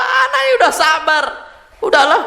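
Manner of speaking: high-pitched mock claim of patience; put-on high voice, gently ridiculing; mocking imitation.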